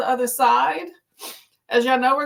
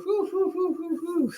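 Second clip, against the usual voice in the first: short soft puffs of air after the stretch; breathy exhales; 6 semitones higher, 5 dB quieter than usual. Real, not acted. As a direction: blow out "whew" in quick soft puffs.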